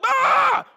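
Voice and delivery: very high, very loud scream of shock; sudden piercing "ah"; startled yell. Retold or imitated. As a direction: cry out as the light hits. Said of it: "this Saul's just like".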